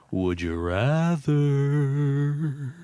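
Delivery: very slow, stretched host announcement; game show announcer. Acted. Introducing the show's game segment.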